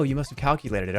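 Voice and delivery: ordinary talk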